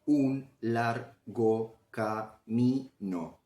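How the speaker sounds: "un largo camino" very slowly, un - lar - go - ca - mi - no; syllable by syllable. Shown as a demonstration. Teacher splitting "un largo camino" for students, syllable by syllable. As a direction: break "un largo camino" into separate syllables with clear pauses. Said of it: "también podemos dividirla en partículas menores".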